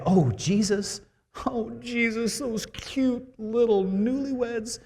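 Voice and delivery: high, slow, syrupy mock-mother voice; hammed-up high sugary mother voice; mock sweet Mary. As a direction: coo it like a doting mom. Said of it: "She didn't say".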